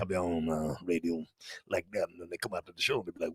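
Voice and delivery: low, hushed put-on performer voice; artist voice. Comic explains his radio act, artist voice. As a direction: stay low and soft in character.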